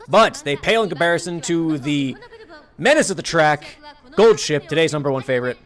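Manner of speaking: loud, brisk racetrack caller; race announcer. Acted. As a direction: call the race like a hyped track announcer. Mock race announcer call.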